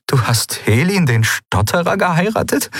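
high incredulous question, rising steeply at the end; stunned disbelief. A narrator's reading. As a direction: ask it as if you cannot believe it. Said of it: "Lien blinzelte fassungslos". (fassungslos ungläubig)

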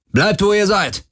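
high-pitched command, a narrator's contained shout; roared order. A narrator's reading. Intruder orders the bridge crew to freeze, contained high shout. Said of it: "brüllte einer von ihnen".

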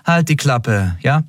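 bored, offhand rebuke. A narrator's reading. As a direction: shut them up with weary boredom. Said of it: "begann Endriel gelangweilt".

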